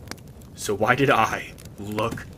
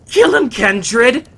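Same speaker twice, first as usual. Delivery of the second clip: frantic lute player; 15 dB louder than usual. Acted. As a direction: shriek him on to kill. Lute player urging the kill.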